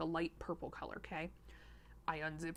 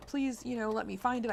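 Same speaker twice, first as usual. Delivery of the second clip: higher, louder pleading plea to strangers; mock pleading; 5 semitones higher, 5 dB louder than usual. Poster pleading with the public, imitated higher and louder. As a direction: beg the public to help find it.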